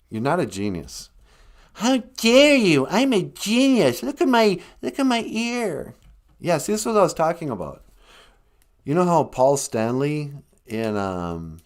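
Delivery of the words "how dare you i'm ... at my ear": hammed-up outrage; outraged artist. Van Gogh outraged.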